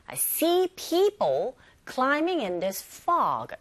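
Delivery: SEE, PEOPLE, CLIMBING, FOG stressed and drawn out, "in this" light; slow model sentence, punch see, people, climbing, fog; stress on content words. Shown as a demonstration. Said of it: "거기에 힘을 줘서 발음하시면". (see, people, climbing, fog에 힘을 주어 천천히)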